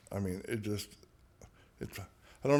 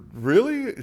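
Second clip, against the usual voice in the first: high, loud incredulous really; amused disbelief; 10 semitones higher, 8 dB louder than usual. Retold or imitated. Patient answering the therapist, amused and incredulous. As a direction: laugh off the question in disbelief. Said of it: "I started laughing".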